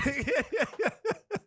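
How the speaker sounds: high, breaking-up laughter; real laughing fit. Real, not acted. Cracking up after playing devil's advocate, real laughter. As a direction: burst out laughing and keep going. Laughing at own blunt remark.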